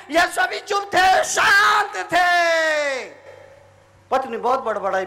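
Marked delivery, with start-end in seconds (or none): 0.1-3.1 high shout with drawn-out ending; ringing slow finish; loud proclamation. Shown as a demonstration. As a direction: call it out, stretching the last words. Preacher proclaims to crowd.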